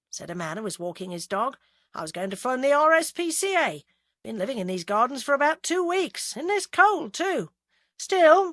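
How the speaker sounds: chatty passerby, lightly voiced; a lightly voiced stranger making conversation; man with dog. A narrator's reading. Passerby chatting to Agatha, lightly voiced.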